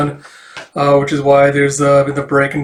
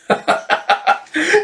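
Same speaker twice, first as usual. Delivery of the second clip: high burst of real laughter. Real, not acted. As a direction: break into a laugh. Reader laughs at the banner.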